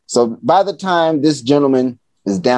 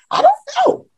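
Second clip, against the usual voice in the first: squeaky high voice for the dad; faster than usual. Retold or imitated. Dad on the phone answering in a high voice. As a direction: pitch the dad's reply way up.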